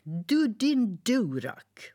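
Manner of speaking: furious high shout, slow and loud; boy yelling at the passing boat; angry yell. Acted. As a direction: scream it at the boat in a rage. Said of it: "blev jättearg och skrek".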